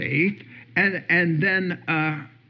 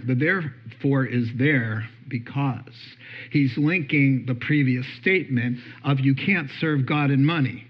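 ordinary talk

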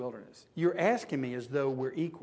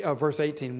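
ordinary delivery

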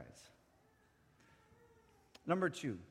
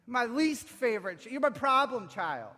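high, loud voice of outraged reproach; scolding synagogue ruler; 10 semitones higher, 8 dB louder than usual. Acted. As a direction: berate them as an oppressive ruler. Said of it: "Does he sound like the synagogue ruler?".

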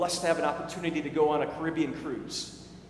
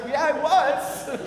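wry laugh; 8 semitones higher, 5 dB louder, slower than usual. Retold or imitated. Pastor's pointed reply to stranger.

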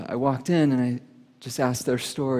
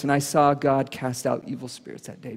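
usual speaking voice

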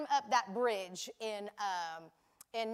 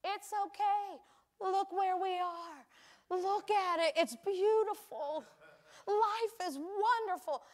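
higher, slow, gentle reassurance; reassuring husband; 6 semitones higher, slower than usual. Retold or imitated. Husband comforting his wife after her mistake, slow and gentle. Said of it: "the husband's like".

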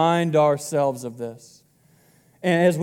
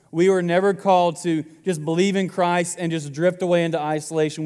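ordinary delivery

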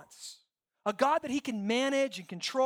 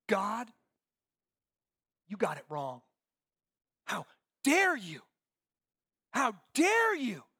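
slow, drawn-out how dare you; mock indignant Jonah, slowed down; indignant accusation; 3 semitones lower, slower than usual. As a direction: let the outrage stretch each word.

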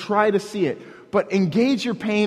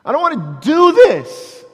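higher, louder whiny complaint; whining; 8 semitones higher, 8 dB louder than usual. Retold or imitated. Preacher mocking an artistic whining Jesus, whiny. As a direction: whine it like a sulky child. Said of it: "Jesus would be whining here".

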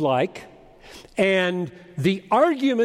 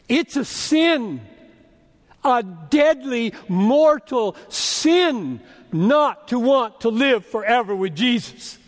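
slow, high, emphatic preaching; forceful, high-pitched warning from the pulpit; solemn denunciation; 4 semitones higher, slower than usual. Real, not acted. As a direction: hammer each key word, drawn out. Preacher denouncing a deadly sin.

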